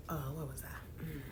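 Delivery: whispering to herself, lost the thread; a quick hushed aside; unvoiced whisper, where was I. Real, not acted. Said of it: "aside losing her thread".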